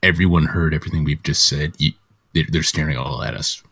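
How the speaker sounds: usual speaking voice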